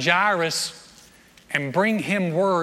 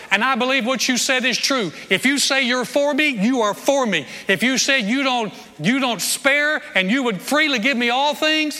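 ringing, louder prayer full of conviction; fervent prayer; 6 semitones higher, 4 dB louder than usual. Shown as a demonstration. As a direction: pray it out with bold confidence. Models a bold prayer.

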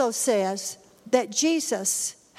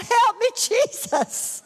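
much higher, slow, pleading "Help me, Jesus"; crying out for help; 10 semitones higher, slower than usual. Retold or imitated. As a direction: reenact calling on Jesus from the furnace. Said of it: "I cried out".

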